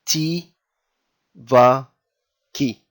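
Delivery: "T, va, ki" spaced out, "va" stressed; teacher spelling out the word; syllable by syllable. Shown as a demonstration. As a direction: sound out "T, va, ki" slowly. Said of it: "So this is".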